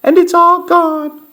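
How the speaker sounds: tiny, very high cub voice; squeaky Baby Bear. A narrator's reading. Baby Bear finds his porridge was eaten, tiny squeak. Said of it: "squeaked Baby Bear in his tiny little voice".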